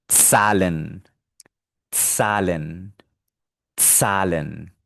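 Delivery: "Zahlen" with initial ts and long a. Shown as a demonstration. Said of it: "mejor calidad de sonido y la digo".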